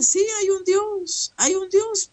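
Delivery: lightly mock the half-hearted believers; imitating doubters. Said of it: "Aunque ellos dicen". (imitando con burla, voz mucho más aguda)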